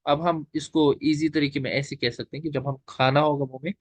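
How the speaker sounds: ordinary delivery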